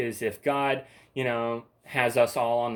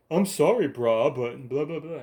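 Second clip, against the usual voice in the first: mocking commenter; 2 semitones higher, 3 dB louder than usual. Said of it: "after calling me bruh".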